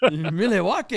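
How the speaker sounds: "Milwaukee" as "Mil-e-wauk", rising like a question; echoing the mock pronunciation. Cohost echoes the joke, saying "Milwaukee" as "Mil-e-wauk" with a rise. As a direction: playfully repeat the joke "Milwaukee". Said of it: "[name] mansplaining the English language".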